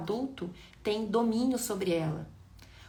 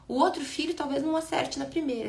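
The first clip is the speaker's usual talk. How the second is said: ordinary talk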